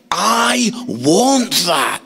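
slow, heavily stretched emphasis on "I want that"; drawn-out longing. Retold or imitated. Dad reading about an admiring son, drawn-out longing. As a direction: stretch out every word with longing. Repeats wish, far more emphatic.

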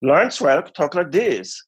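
put-on slow, high cod Scandinavian accent; lilting mock-Scandinavian bandleader. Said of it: "hilarious cod Scandinavian accent".

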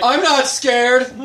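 slow, laughing fake bravado; mock brave. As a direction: pretend to be unafraid. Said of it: "I'm like".

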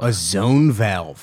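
slow, weighty repeat of the phrase; dramatic slow echo. Real, not acted. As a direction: say it slowly like a big reveal. Echoing the term dramatically.

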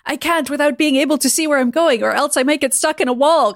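calling back. A narrator's reading. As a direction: call the excuse back to him. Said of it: "Kurt called back".

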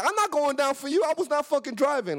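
high-pitched retold protest; exasperated, insistent outcry; indignant denial. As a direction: act out outraged refusal to take blame. Protesting driver's lie to police.

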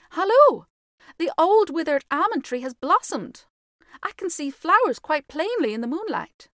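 put-on high, loud, excited boyish voice; wonder-struck, high-pitched announcement; exclaiming twin. A narrator's reading. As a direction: call out a discovery in high excitement. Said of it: "exclaimed one of the twins".

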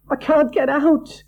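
high, loud, desperate scream; terrified girl screaming in a nightmare; frantic cry. A narrator's reading. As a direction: scream as if trapped. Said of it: "she cried frantically".

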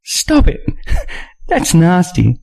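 giggling. Acted. Nina scolding the narrator's joke, giggling under her breath. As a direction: scold him through the giggles.

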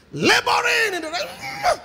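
loud, slow, high strained cry; straining shout. Pretend. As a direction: groan it out like pushing hard. Acting out straining in restroom.

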